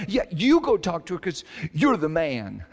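high, mock-bickering imitation of wife; insistent pushback. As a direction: push it onto him, acting out a squabble. Acted wife pushing task onto husband.

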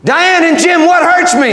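high, fervent summons; calling out. Acted. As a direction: call the couple out at preaching pitch. Jesus calls out to a couple.

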